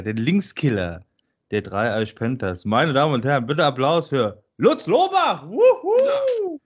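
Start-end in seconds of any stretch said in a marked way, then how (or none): 2.6-5.5 grand entrance announcement. Acted. Introducing guest to applause. 5.5-6.6 high whooping fake crowd cheer; crowd cheer imitation.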